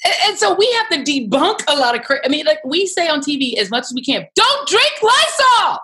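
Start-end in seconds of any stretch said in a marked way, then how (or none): 4.3-5.8 high, slow, loud plea not to drink it; exasperated TV warning. Retold or imitated. Journalist warning TV viewers about Lysol, slow high shout. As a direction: beg the viewers, drawn out and loud.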